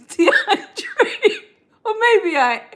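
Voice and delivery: laughing high and breathless through the name; can't hold back the giggles, real; real laughter. Real, not acted. Host laughing at a viewer's comment, breathless giggling. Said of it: "I'm not laughing at you".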